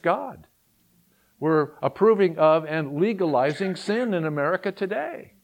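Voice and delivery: rising up to a full preaching voice; forceful preaching. Acted. Pastor warning the congregation about the nation, forceful preaching.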